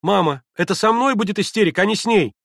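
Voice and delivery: put-on high, loud girlish outburst; agitated cry. A narrator's reading. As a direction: cry out to mother in alarm. Alarmed girl crying out to mother.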